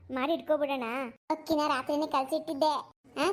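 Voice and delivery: ordinary talk, nothing marked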